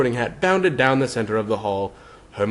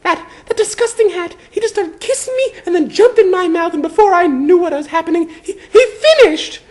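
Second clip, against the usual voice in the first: put-on high, loud girl voice, stammering in disgust; disgusted Hermione; 9 dB louder than usual. A narrator's reading. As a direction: spit out the memory, appalled. Hermione recounts humiliation.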